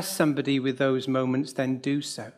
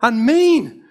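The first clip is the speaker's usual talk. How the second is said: raised, louder mock-indignant voice; mild mock complaint, higher and louder; quoting a blunt friend; 4 semitones higher, 5 dB louder than usual. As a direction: imitate the friend griping about the brother. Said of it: "a friend said".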